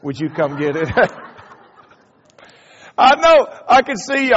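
ordinary talk, nothing marked

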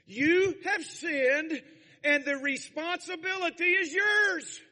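loud, high-pitched pulpit shouting; forceful preaching shout. Real, not acted. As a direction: thunder it at the congregation at full force. Preacher drives home personal responsibility.

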